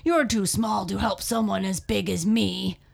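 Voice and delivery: loud, scoffing lion voice; scornful. A narrator's reading. Lion dismissing the little mouse's offer, scornfully. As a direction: scoff loudly as the big lion. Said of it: "he said scornfully".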